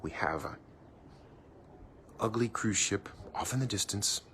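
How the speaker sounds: hushed whisper like a golf broadcast; golf commentator whisper. Pretend. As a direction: whisper it so the golfers can't hear. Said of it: "if I talk like a golf commentator".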